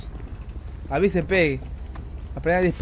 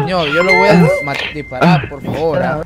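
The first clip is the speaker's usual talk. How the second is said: loud threat; 7 dB louder than usual. Real, not acted. Threatening to shoot passenger.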